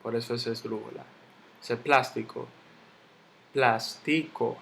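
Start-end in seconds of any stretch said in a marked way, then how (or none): 1.8-4.6 plástico split as plás-ti-co; syllable by syllable. Shown as a demonstration. Teacher sounding out the word for students, slow separate syllables. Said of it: "yo no digo plastico".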